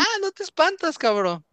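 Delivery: high, teasing dare to the scared brother; playful high taunt while pranking his little brother; mocking taunt. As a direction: needle him in a sing-song high voice. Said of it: "Y dije".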